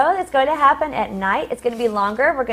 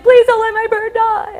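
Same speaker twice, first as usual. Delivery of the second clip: pleading; 10 semitones higher, 6 dB louder than usual. Retold or imitated. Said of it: "so I was like".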